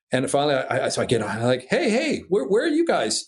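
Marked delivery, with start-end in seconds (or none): 1.7-3.2 eager calling out. Retold or imitated. Acted calling back over the radio.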